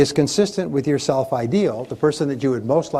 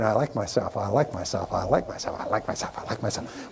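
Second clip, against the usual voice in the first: fading to a whisper; 5 semitones lower, 7 dB quieter than usual. Shown as a demonstration. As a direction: lower and softer, down to a whisper. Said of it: "if you keep repeating".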